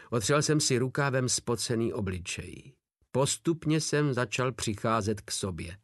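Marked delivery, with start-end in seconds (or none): none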